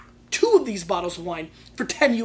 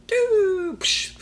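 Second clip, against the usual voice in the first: high falling whistle into an explosive psh; high-pitched throw-and-burst noise; mud bomb sound effect; 12 semitones higher, 4 dB louder than usual. Retold or imitated. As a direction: imitate a bomb flying and bursting.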